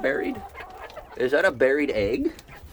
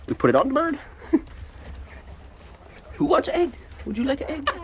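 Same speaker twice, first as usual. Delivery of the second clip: pet talk; faster than usual. Acted. Offering hens an egg.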